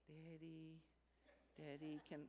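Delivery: soft, small pleading daughter's voice; soft, sweet voice of a daughter begging her dad; sweet little girl voice. Retold or imitated. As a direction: coax like a little girl asking Daddy. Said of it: "she got this sweet little voice".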